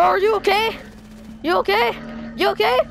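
usual speaking voice

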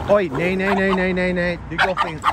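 high, sharp nay nay telling-off; scolding the dog. Real, not acted. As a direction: tell the dog off for going the wrong way. Dog running wrong way.

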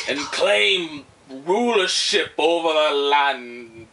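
slow, drawn-out epic quest voice; mock-epic proclamation. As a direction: proclaim a dark legend, slowly. Mock-epic telling of game plot.

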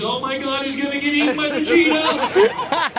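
high, loud, laughing alarm; hammed-up shock at the cheetah; mock panic. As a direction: play a gasping TV viewer. Mock nature-show viewer panic.

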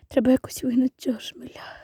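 soft unvoiced plotting; whispering. Planning to chase out the bumblebee, whispering. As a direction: whisper the plan under the breath.

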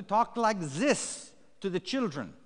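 put-on German accent for entertaining children; playful attempt at a German doctor's accent. Shown as a demonstration. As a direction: talk like a make-believe German doctor. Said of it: "a German doctor".

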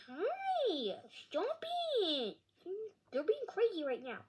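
high, sudden cry at the dog; excited squeal at dog. Real, not acted. Kid yelps at his dog to stop, high excited squeal.

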